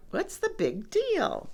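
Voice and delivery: unbothered piggy. A narrator's reading. Piggy unbothered, acted in story.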